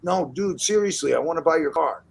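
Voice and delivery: imitated earnest insistence; insistent pleading. Acts kid insisting to the lawyer.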